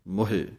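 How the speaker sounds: ਮੋਹੇ with a clear he sound; ਮੋਹੇ pronounced mo-he; Gurbani pronunciation. Shown as a demonstration. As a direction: say ਮੋਹੇ as mo-he, he with sihari. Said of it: "ਮੱਮੇ ਔਂਕੜ".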